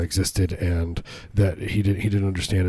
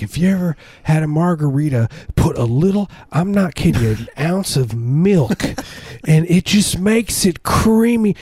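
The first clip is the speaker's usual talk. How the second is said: put-on southern accent; 8 semitones higher than usual. Bartender mocking the drunk coworker's milk margarita tip, Southern accent. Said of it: "he has a southern accent".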